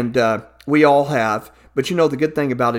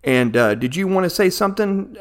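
ordinary delivery

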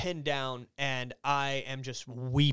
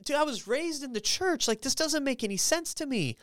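incredulous protest; 11 semitones higher than usual. Retold or imitated. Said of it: "and I'm like".

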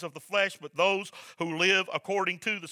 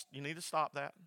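quiet, even motherly warning; a hint of a calm mother setting a limit; 7 dB quieter than usual. Pastor imitating his mama calmly warning, quiet. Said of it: "Mom would tell you calmly".

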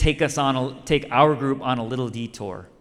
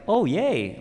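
high, bright-eyed "Oh, yay"; hammed-up glee at leaving the nets; mock cheerful; 8 semitones higher than usual. Disciples mock-happily skipping off after Jesus. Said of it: "happily skipped off".

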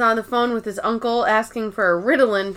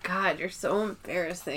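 much softer, lower whiny groan; embarrassed teen groan; 4 semitones lower, 12 dB quieter than usual. Retold or imitated. Ryan mortified by his mom, soft low teen groan.

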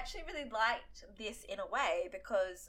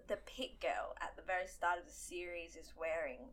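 hushed; 4 semitones lower, 10 dB quieter than usual. Real, not acted. Whispered plot detail.

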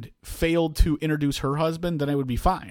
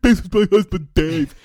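loud, raised put-on voice; mock introduction; 4 semitones higher, 10 dB louder, slower than usual. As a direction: belt it out as a mocking impression. Mocking wife's introduction.

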